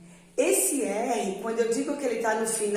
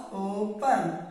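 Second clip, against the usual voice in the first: usual speaking voice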